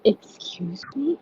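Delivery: offended whisper. Real, not acted. Mock offended at insult.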